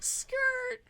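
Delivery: high, loud mocked skid noise; mock skidding tyres; tire screech. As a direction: imitate a car screeching to a stop. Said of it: "pulls up and is like".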